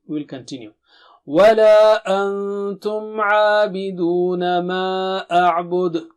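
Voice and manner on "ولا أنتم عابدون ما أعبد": teacher chanting the verse; Quran recitation. Shown as a demonstration.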